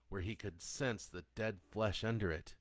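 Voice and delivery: plain reading voice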